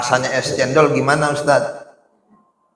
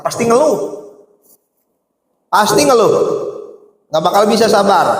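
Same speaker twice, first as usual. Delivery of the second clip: high, needling teacher's taunt; teasing Khidir; 7 semitones higher than usual. Acted. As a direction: tease the eager student, pitched well up.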